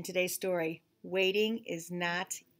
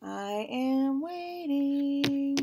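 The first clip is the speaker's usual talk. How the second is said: very slow, stretched words; drawn-out waiting; 6 semitones higher, much slower than usual. Acted. Gerald tells Piggy he waits, very slow drawl. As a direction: drag every word out.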